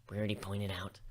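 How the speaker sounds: ordinary delivery, nothing marked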